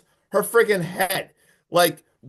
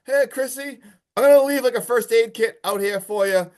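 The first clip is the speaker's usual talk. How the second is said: timid call; 6 semitones higher than usual. Retold or imitated. Streamer timidly calling to a possessed girl.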